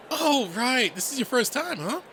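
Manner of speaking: high, loud, bubbly friend voice; excited realisation. Acted. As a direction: burst out with sudden delight. Redline beams, realising it's first time.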